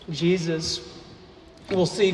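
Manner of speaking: ordinary talk, nothing marked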